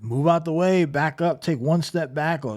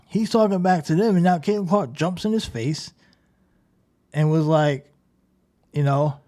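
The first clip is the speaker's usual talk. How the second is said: ordinary talk, nothing marked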